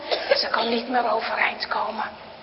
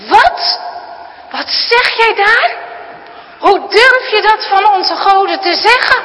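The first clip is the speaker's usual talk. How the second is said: loud, high outraged shout; a grandfather exploding at his granddaughter; furious grandpa; 9 semitones higher, 9 dB louder than usual. Acted. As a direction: grandpa roaring at the girl's words. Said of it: "Woest springt hij overeind".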